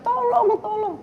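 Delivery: high, panicked imitated plea; high mock scream for help; frightened cry for help. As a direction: cry out to God in terror.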